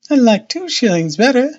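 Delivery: put-on high-pitched little boy voice; small boy. A narrator's reading. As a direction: pipe up high like a hopeful child. Narrator voices small boy Jack.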